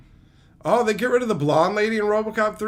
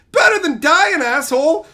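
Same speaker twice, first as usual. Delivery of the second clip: much higher, louder scornful shout; exasperated yell; 10 semitones higher, 8 dB louder than usual. Real, not acted. Streamer yelling at the Cyclops's logic, scornful shout. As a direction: snap back at the screen.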